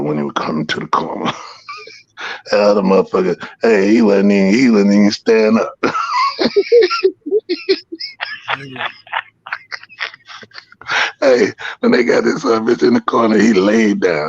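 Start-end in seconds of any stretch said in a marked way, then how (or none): 5.8-8.9 high, long delighted laugh; a rolling laugh of triumph; big laugh. Real, not acted.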